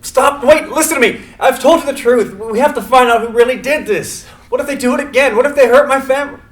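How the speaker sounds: high, loud desperate yells; yelling in protest. Acted. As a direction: scream it as the bag goes on. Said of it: "Simon yells in protest".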